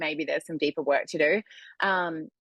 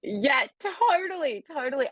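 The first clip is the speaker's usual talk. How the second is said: amused agreement; 10 semitones higher than usual. Real, not acted. Agreeing after laugh.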